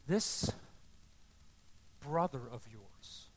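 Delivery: slow, low, measured fatherly words; very calm. Acted. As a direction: answer the anger with quiet patience. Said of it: "Very calmly says".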